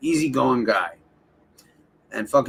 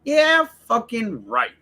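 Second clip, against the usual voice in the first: sarcastic disbelief; 8 semitones higher, slower than usual. Retold or imitated. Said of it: "I'm like".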